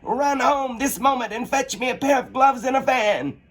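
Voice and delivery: high, bossy rabbit voice. Acted. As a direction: order her off at once. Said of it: "called out to her".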